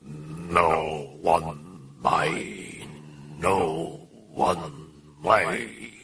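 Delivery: very slow, low possessed mutter; guttural muttering. A narrator's reading. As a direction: drag the sounds out, low and guttural. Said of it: "mutter something incomprehensible in an oddly guttural tone".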